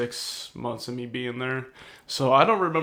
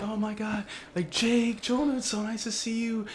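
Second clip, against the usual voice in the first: put-on excited girl; 9 semitones higher, 5 dB quieter than usual. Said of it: "she's like".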